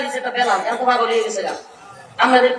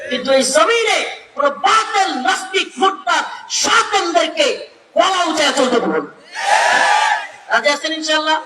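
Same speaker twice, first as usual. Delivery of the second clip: preaching at full force; 3 semitones higher than usual. Acted. Preacher to the waz crowd on God's power, preaching at full force. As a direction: proclaim God's might to the crowd like a revival preacher.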